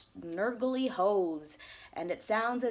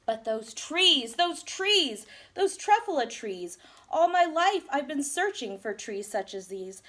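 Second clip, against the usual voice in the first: louder, rapturous praise; awestruck; 4 semitones higher, 6 dB louder than usual. A narrator's reading. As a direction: marvel at the trees. Awed by the trees.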